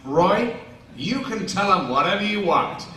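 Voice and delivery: loud, insistent comeback; punchy mock-defiant declaration; emphatic retort. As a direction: push back firmly and loudly. Retold emphatic refusal to Roy.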